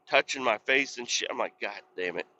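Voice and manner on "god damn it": muttered curse. Retold or imitated. Retold annoyance on the bike.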